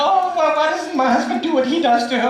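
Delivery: mocking whiny wife. Mocking a wife watching soaps.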